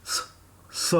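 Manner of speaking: a long unvoiced hiss, s; przeciągłe bezdźwięczne s; hissed s sound. Shown as a demonstration. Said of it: "now we'll pronounce s".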